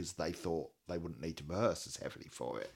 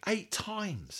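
very high, disbelieving pitch; incredulous; 13 semitones higher than usual. Real, not acted. Host aghast at another neglected song, incredulous. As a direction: send the count up high in disbelief.

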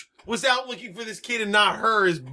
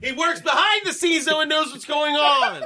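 raised, high-pitched insistence; emphatic shouting; 7 semitones higher than usual. Real, not acted. Podcaster insisting to a co-host, emphatic shout. Arguing over the dog's purpose.

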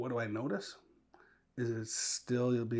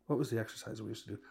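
ordinary talk, nothing marked

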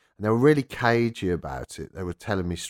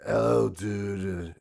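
lightly voiced laid-back rocker; much slower than usual. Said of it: "he's like".

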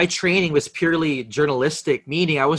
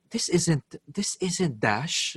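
softer and slow, hushed suspicious caller; imitated hushed caller; 9 dB quieter, much slower than usual. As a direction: murmur it slowly, puzzled and secretive. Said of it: "he'd be whispering".

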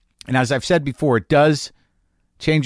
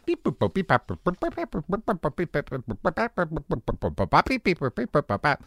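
machine beeping noises; 3 semitones higher, 7 dB quieter than usual. Shown as a demonstration. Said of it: "The one we use for the machine".

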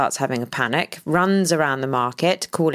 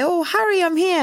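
mock sing-song greeting; 13 semitones higher than usual. Mock greeting voice in retold scene.

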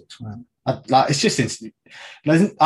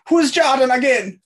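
mock quote; 7 dB louder than usual. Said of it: "all I could think of was".

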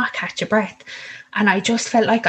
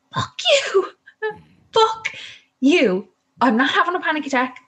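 much higher, exasperated inner retort; mock inner outburst; 8 semitones higher than usual. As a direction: snap back silently at the midwife. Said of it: "I went in my head".

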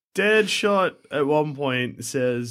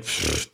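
soft blown raspberry-like puff; dismissive pfft; 9 semitones lower, 6 dB quieter than usual. Retold or imitated. Studio waving away Man of Steel, dismissive pfft. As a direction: puff it out, brushing off the problem. Imitating studio shrugging off problem.

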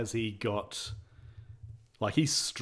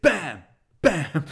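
loud comic "Bam!"; mock punch sounds; 6 semitones higher, 8 dB louder than usual. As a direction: shout it like a punch landing. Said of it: "This is what he did".

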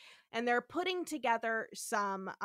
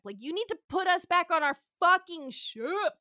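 exasperated demand, higher and louder; Kirk; 7 semitones higher, 6 dB louder than usual. Retold or imitated. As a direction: hammer out the order, exasperated. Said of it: "Kirk is like".

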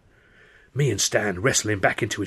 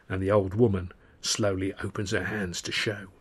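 plain reading voice

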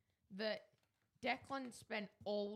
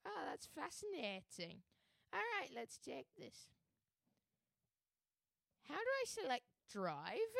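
higher-pitched Scottish learner driver; put-on Scottish accent; 5 semitones higher than usual. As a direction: play a curious Scotsman at the wheel. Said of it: "he's going to be using a Scottish accent".

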